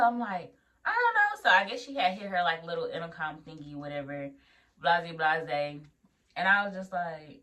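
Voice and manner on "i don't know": high-pitched retold helplessness; squeaky mock cluelessness; flustered reply. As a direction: blurt it way up high. Retold flustered answer to instructor.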